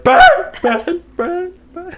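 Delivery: high, loud mock scream of a name. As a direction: yell it like a freaked-out dad. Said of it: "He screamed it".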